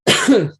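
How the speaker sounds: loud choking cough; hacking cough, swallowed wrong; coughing up water. Real, not acted. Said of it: "Just went down the wrong way".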